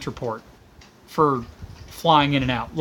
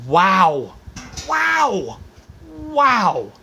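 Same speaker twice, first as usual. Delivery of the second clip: stunned wow; 4 semitones higher, much slower than usual. Real, not acted. Stunned disbelief at politics.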